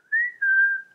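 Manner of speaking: very high, loud squeal of laughter. Real, not acted. Admitting she's going kooky, loud high squealing laugh. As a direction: burst into a shrieking giggle.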